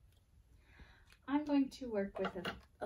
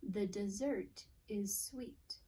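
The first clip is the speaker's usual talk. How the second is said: slow, careful, near a whisper; slow reading aloud; slower than usual. Shown as a demonstration. Teacher reading the next sentence to pupils, slow and hushed. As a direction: read each word slowly for the class. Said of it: "Here's my next sentence".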